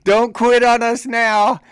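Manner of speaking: high, loud mocking shout; retold taunt. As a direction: goad him not to give up. Soldiers taunting sergeant.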